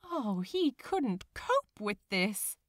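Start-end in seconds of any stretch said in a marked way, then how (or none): none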